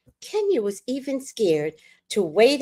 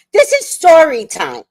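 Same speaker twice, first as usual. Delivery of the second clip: higher and louder, pressing each word; emphatic insistence; 7 semitones higher, 6 dB louder than usual. Real, not acted. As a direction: insist on it, raising the voice.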